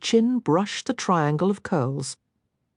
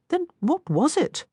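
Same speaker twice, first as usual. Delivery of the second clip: woman's voice; 11 semitones higher than usual. Acted. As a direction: lift into the heroine's voice. Merritt voiced higher.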